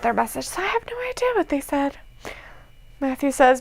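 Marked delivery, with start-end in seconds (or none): 0.5-2.0 whispering. Real, not acted. Aside while reading chat.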